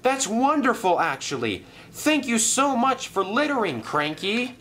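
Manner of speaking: high, bright relief; thrilled thanks; overjoyed Thomas. Acted. Thomas thanks Cranky, overjoyed.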